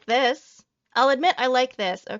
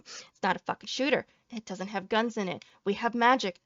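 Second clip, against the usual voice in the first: usual speaking voice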